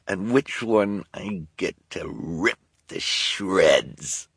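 sneering villainess voice; gloating villain. Acted. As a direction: relish every cruel word.

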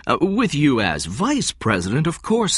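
eager to smooth things over; said quickly. A narrator's reading. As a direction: put it in quickly, as an afterthought. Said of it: "Stone said quickly".

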